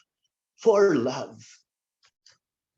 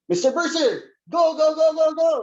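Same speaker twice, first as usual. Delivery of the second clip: high, loud barked commands; captain shouting orders; 9 semitones higher, 5 dB louder than usual. Retold or imitated. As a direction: yell go like a drill captain. Captain ordering him to jump.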